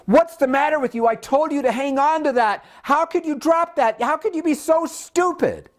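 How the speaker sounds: high, fast exasperated scolding; mother scolding her daughter over a spilled drink, sharp and fast; mock scolding mom. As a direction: snap at the little girl like a fed-up mother. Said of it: "and goes".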